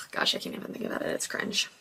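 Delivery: cringing whisper; hushed cringe aside. Real, not acted. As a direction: whisper it, embarrassed.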